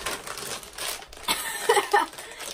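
high breathy giggle; laughing. Real, not acted. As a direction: burst into a giggle. Laughs at own joke.

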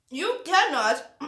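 talking through food; mouth full. Real, not acted. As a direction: speak round a mouthful. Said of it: "I'm speaking with my mouth full".

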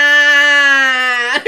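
sudden loud far-off cry of shock. Retold or imitated. She sees blood and cries out, loud and startled. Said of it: "vi sangre y dije".

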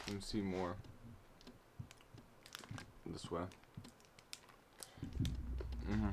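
chewing while talking; mumbling around a bite; mouth full of chocolate. Real, not acted. Seen 2: Bites nut chocolate bar at 0.8–2.0, then chews with closed lips.

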